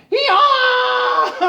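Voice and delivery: loud, high, drawn-out hee-haw; braying donkey. Acted. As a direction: bray like a crying donkey. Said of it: "just braying and crying".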